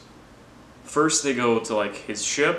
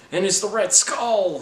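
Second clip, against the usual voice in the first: higher, slower, louder reveal; excited reveal; 10 semitones higher, 4 dB louder, slower than usual. Real, not acted. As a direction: land the twist with glee. Big reveal in recap.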